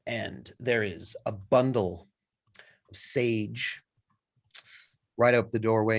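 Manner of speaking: ordinary talk, nothing marked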